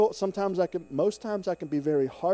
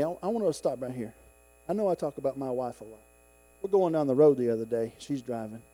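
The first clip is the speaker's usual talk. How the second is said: ordinary talk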